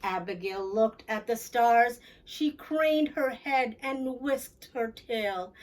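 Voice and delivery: plain reading voice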